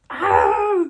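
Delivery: loud, high burst of excitement; excited squeal. Real, not acted. Announcing a first poetry collection, excited squeal.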